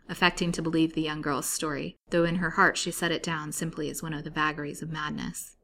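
plain reading voice

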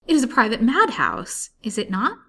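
high, loud, emphatic challenge; alarmed accusation. A narrator's reading. Patient realizing she is in a madhouse, alarmed. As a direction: confront her with the horrible suspicion.

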